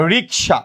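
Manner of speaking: রিকশা with k before sh; standard pronunciation. Shown as a demonstration. Teacher showing students one pronunciation, রিকশা as "rik-sha". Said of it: "কেউ বলে".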